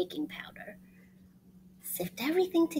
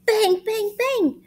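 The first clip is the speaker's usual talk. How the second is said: high loud bang bang bang; 10 semitones higher, 8 dB louder, slower than usual. Acted. Storyteller making the crash noise, loud high bangs. Cake crashing sound effect.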